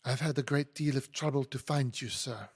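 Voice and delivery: put-on complaining in a French accent; lightly put-on French accent. Hortense confronts the elusive lawyer, French accent. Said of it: "apologize for my French accent".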